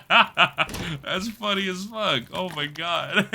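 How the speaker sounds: slow words broken by real laughter; cracking up at a chat message; laughing. Real, not acted.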